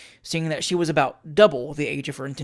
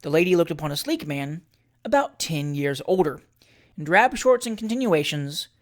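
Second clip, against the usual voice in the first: plain reading voice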